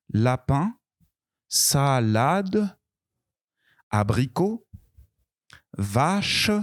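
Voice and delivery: each word spoken apart, slow and clear; dictation reading. Shown as a demonstration. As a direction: read the word list for pupils to hear. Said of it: "Il faut écouter". (lecture de mots lente et articulée)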